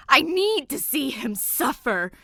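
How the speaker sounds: high, loud furious yell; tantrum yell. A narrator's reading. Ghost bride screaming her demand at Danny. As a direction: scream it like a spoiled brat.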